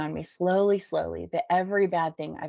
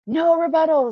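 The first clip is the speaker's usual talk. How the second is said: very high, loud group scream; 12 semitones higher, 10 dB louder than usual. A narrator's reading. Whole room shuts down the newcomer, screamed. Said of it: "screams the room".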